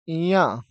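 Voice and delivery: "य" nasalised, as nya; isolated nasal nya for "य"; palatal nasal. Shown as a demonstration. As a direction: start "य" with a palatal n, then open to a. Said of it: "इनका सही उच्चारण".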